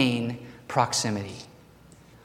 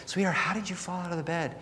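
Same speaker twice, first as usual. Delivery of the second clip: soft, higher, much quieter motherly voice; tender mother; 5 semitones higher, 12 dB quieter, faster than usual. Retold or imitated. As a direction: hush it like a mom soothing her child. Mother asking her child.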